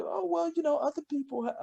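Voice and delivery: very high mock student voice; imitating coaxing students. Retold undergrads coaxing the teller.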